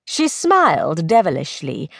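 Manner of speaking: usual speaking voice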